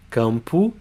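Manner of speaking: campo said KAHM-poo, final O as U; Portuguese pronunciation. Shown as a demonstration. Teacher models campo for students, final O as U. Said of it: "has an U sound".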